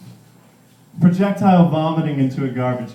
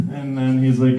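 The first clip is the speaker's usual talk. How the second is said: ordinary delivery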